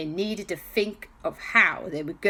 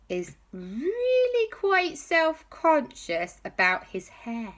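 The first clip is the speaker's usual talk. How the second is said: drawn-out reveal; 9 semitones higher than usual. Acted. J B reveals embarrassing secret.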